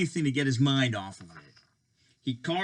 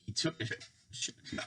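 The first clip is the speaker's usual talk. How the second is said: hiccupping; 12 dB quieter, faster than usual. Pretend. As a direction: stammer broken by hiccups. Reader playing hiccup interruption.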